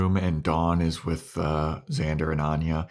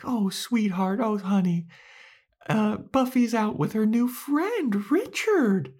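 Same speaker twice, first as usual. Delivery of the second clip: very high, sugary talking-down voice; mock patronizing Anya. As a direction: coo at her like a small child.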